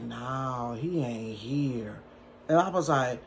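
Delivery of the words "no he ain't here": low, soft, slow man's voice; lightly voiced low-key man on the phone; roommate's voice. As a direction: drop low and lazy like the guy.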